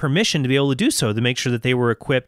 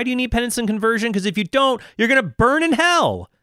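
hellfire preacher; 8 semitones higher than usual. Pretend. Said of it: "He preached hellfire and brimstone".

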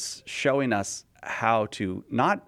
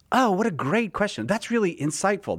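higher, mock-flattering AI voice; gushing chatbot; 7 semitones higher than usual. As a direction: lay the praise on thick like a fawning assistant. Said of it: "it loves you".

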